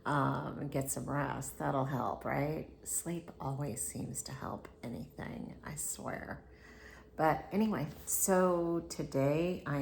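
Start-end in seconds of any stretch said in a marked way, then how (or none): 2.8-6.4 soft unvoiced whispered aside; hushed confiding whisper. Real, not acted. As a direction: whisper it like a little secret. Aside to the camera.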